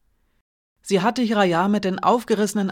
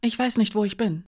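trembling voice; 3 semitones higher than usual. A narrator's reading. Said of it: "sagte sie mit zitternder Stimme".

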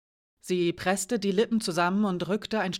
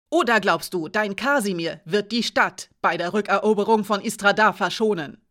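angry accusation; 2 semitones higher, 6 dB louder than usual. Acted. Alina accuses the thief angrily. (zornig, langsam und laut)

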